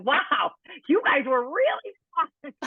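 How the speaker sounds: high, slow, laughing mock praise; laughing sarcasm. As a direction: tease them through laughter. Teasing the staff after the weigh-in.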